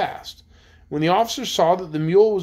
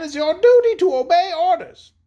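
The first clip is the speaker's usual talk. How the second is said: high, loud, imperious insistence; insistent cry; 13 semitones higher, 6 dB louder than usual. A narrator's reading. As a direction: cry out the order at high pitch. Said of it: "cried Anne".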